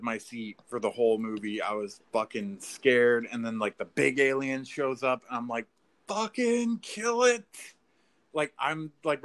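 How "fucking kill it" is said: slow, high, intense plea; will the hero to kill it; urging on. Retold or imitated. Cheering Ripley against the big alien, slow intense urging.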